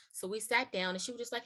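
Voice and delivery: usual speaking voice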